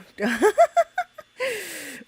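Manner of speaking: high cackle; cackling laugh. Real, not acted. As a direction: let out a high witchy cackle. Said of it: "you have a great laugh".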